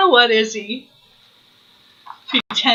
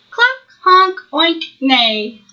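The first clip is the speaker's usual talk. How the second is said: animal noises; 4 semitones higher, slower than usual. Acted. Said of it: "Duddle danced and sang".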